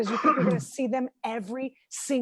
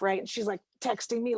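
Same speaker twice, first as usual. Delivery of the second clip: normal talking voice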